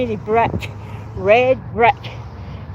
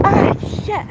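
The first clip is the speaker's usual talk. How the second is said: sudden pained yelp, pitched high; startled curse; 10 semitones higher than usual. Real, not acted. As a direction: blurt it out as it hits you. Knocks head on a branch.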